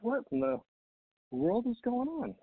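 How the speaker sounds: high-pitched, baffled exclamation; bewildered. Retold or imitated. Speaker retelling his reaction to twelve guests, bewildered. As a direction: drag out the disbelief, voice lifted high.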